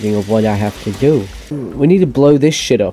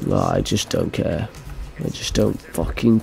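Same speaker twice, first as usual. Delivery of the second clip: defeated murmur; 6 semitones lower, 9 dB quieter than usual. Real, not acted. Defeated gamer.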